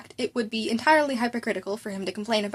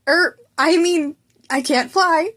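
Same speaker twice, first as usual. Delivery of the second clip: louder, slow, fumbling backpedal; flustered cover-up; 6 semitones higher, 8 dB louder, slower than usual. Acted. Student covering his slip before the teacher, flustered.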